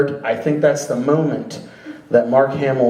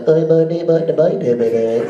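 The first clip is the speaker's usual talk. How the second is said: robot babble. Retold or imitated. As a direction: imitate a droid burbling nonsense. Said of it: "it's like".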